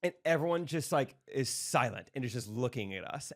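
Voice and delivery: ordinary delivery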